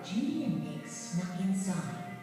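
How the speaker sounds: usual speaking voice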